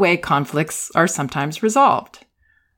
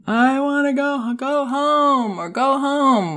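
put-on high, slow, drawn-out child's whine; imitating a whiny little boy begging to go home; upset toddler; 9 semitones higher, slower than usual. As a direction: whine it like a two-year-old who wants to leave. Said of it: "Ben says".

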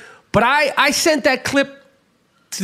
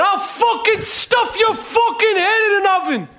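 furious shout; 8 semitones higher than usual. Acted. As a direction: bellow it right in his face. Ranting at imagined Nazis.

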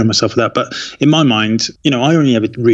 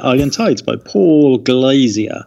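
ordinary talk, nothing marked

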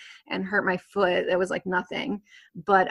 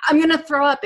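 queasy panic; 7 semitones higher, 7 dB louder than usual. Retold or imitated. About to vomit, retold.

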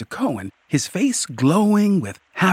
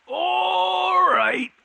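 troll's growl. A narrator's reading. Troll challenging a rider on its bridge, high growl. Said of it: "it growled".

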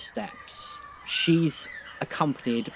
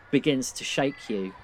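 ordinary talk

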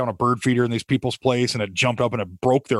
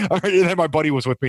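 normal talking voice

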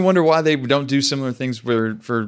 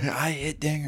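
put-on raspy kid voice; 3 semitones higher, 10 dB quieter than usual. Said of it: "a little raspy voice".

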